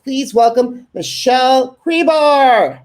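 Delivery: stretched-out welcome for the guest; announcer welcome. Real, not acted. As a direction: bring the guest on like a host. Host introducing guest on show.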